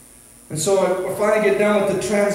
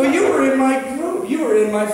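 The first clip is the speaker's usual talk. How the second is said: higher, eager retold exclamation; excited recognition; 7 semitones higher than usual. Pastor placing a checker from his old group, excited recognition. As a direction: raise the pitch as the memory lands.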